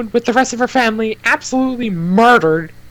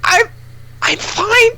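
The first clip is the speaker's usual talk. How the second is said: strained character voice; 10 semitones higher than usual. Acted. Character chokes out a lie.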